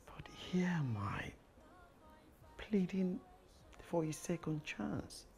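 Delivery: slow, breathy begging; pleading. Acted. Man begging Angie for a second chance. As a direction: beg her to take him back.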